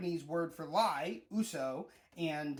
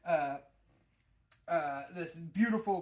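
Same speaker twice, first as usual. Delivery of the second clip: ordinary delivery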